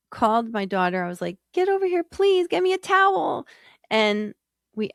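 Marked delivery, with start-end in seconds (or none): 1.5-3.5 much higher, urgent plea for help; frantic call. Retold or imitated. Host retelling how she called her daughter for a towel, scared and pleading. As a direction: call out in a scared, high rush. Calling daughter while trapping raccoon.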